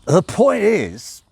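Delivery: put-on loud, high, slow drunken drawl; drunk Crowley. Crowley drunk at the table, loud slurred drawl. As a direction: slur it out like a drunk demon.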